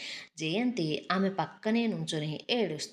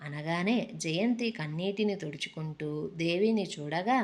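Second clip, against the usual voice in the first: usual speaking voice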